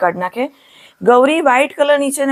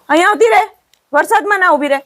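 calling out; 9 semitones higher, faster than usual. Real, not acted. Calling cow out of rain.

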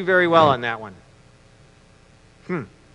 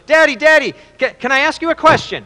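put-on high, eager little-boy voice; acted excited kid, pitched high; five-year-old son; 8 semitones higher, 4 dB louder than usual. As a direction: pipe up like a small boy calling Dad. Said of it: "his son goes".

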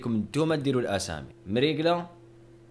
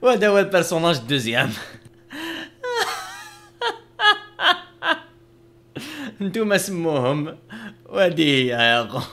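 louder, higher proud showing-off; proud reveal; 8 semitones higher, 7 dB louder than usual. Real, not acted. Showing viewers the finished wife, loud and proud. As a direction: present it like a masterpiece.

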